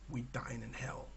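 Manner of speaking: low, soft mock-epic line; mock ominous. Gamer ends with a mock-ominous catchphrase, soft. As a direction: drop it like a grim battle cry.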